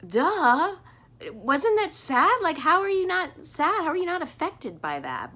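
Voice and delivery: high-pitched, exasperated "isn't it obvious" retort; acted incredulity. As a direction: act baffled that anyone could be unmoved. Acting out own exasperated inner reply.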